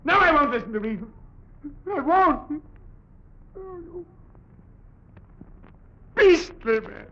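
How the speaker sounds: high, fast, desperate accusation; rising hysteria breaking into tears; hysterical outburst. Acted. Ventriloquist accuses rival of theft, rising to hysterical tears.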